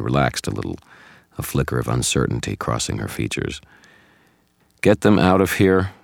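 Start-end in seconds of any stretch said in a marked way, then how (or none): 4.8-5.9 loud, higher, forceful command; menacing order. A narrator's reading. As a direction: order them out with an ugly edge. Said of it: "there was a note to his voice that I didn't like".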